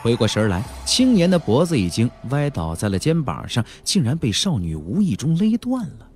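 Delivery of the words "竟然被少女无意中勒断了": ordinary delivery, nothing marked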